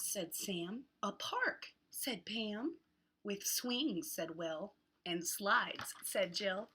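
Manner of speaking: lively picture-book read with voiced characters; reading to children. Teacher reading a beach book aloud to kids, lively. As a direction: read it like story time for little ones.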